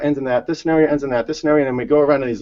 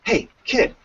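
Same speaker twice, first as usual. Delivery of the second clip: higher, lightly voiced grumpy neighbor; neighbor calling out; 8 semitones higher than usual. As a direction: call to the kid in the bush from the porch. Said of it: "and he goes".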